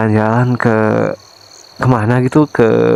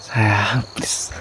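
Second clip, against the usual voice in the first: softer pet name, then a whispered please; pleading whisper; 8 dB quieter than usual. Acted. Husband whispering a plea to his silent wife. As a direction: beg her under your breath.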